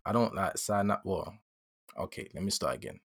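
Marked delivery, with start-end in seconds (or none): none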